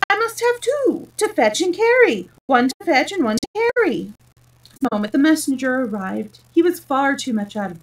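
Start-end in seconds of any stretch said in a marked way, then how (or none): 0.1-4.1 higher, emphatic insistence; impatient king. A narrator's reading. King explaining his messengers to Alice, impatient. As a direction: spell it out impatiently, pitch raised. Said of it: "The king patiently".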